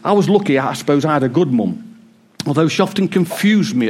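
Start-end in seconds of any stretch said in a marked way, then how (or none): none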